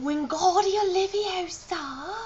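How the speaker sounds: high, slow spell-casting in mock-British; hammed-up British wizard casting a spell; Harry Potter. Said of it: "I am channeling Harry Potter".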